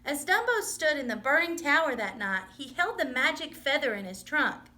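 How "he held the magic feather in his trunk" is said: plain reading voice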